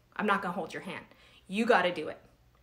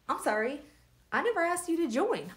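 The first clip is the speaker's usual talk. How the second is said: sassy retort; 6 semitones higher than usual. Retold or imitated. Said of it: "She was so mean".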